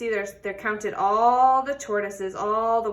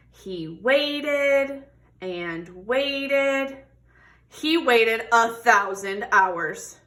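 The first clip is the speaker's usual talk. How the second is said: expressive picture-book read-aloud; reading to children; 4 dB louder than usual. Acted. Teacher reading a tortoise story to children, expressive. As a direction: tell the story warmly to a class.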